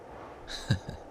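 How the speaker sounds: breathy, quiet wicked chuckle; soft evil chuckle. Acted. Demon gloats to the listener about the stabbed friend, soft evil chuckle. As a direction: chuckle under your breath, pleased.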